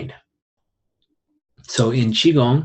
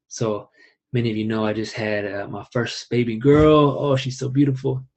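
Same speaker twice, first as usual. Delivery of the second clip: ordinary delivery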